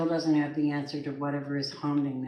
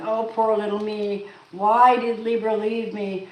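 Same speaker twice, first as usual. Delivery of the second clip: higher, slow whiny mock pity; mock self-pity; 5 semitones higher, 3 dB louder than usual. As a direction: whine it like a pitiful victim, mocking. Mocking the self-pitying partner.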